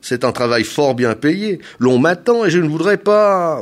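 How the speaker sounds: loud, high, hurried-to-leave explanation; impatient. A narrator's reading. Hair planter explaining his trade while expected elsewhere, impatient. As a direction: explain emphatically, eager to get away. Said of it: "avec un rien d'impatience".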